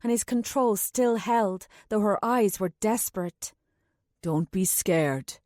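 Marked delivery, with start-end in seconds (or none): 4.2-5.4 reassuring. A narrator's reading. Anulan calms the little girl, low and slow. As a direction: soothe the frightened child. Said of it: "Anulan said to the little girl".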